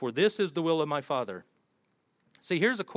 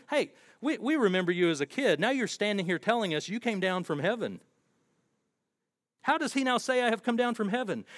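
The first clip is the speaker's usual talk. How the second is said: higher, scoffing townsfolk, lightly voiced; mocking crowd; 6 semitones higher than usual. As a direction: sneer at the carpenter's son's claim in a higher voice.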